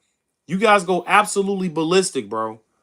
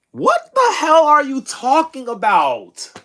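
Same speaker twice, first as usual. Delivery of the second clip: higher, slow, incredulous what the hell; exasperated disbelief; 6 semitones higher than usual. Real, not acted. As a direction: stretch it out in total disbelief at the chat. Streamer arguing with chat, incredulous.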